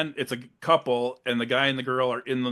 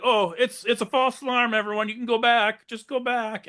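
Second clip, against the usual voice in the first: higher sheepish call to the crowd; high mock sheriff; 9 semitones higher than usual. Sheriff telling beachgoers it was a false alarm, high sheepish voice. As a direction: pitch up and try to sound casual.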